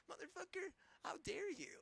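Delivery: very high, strained cry of disbelief; real fury, pitch cracking upward; outraged shriek. Real, not acted. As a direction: scream it up high like you can't believe them. Peak of the rant at them.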